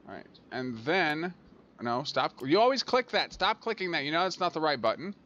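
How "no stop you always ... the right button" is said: exasperated scolding. Real, not acted. Player telling self off for the wrong click, exasperated scolding. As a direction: scold sharply on a high pitch, easing slightly.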